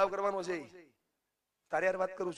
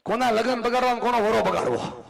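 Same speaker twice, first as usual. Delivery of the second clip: laughing tease; 6 semitones higher, 4 dB louder than usual. Real, not acted. Teasing crowd to join hands.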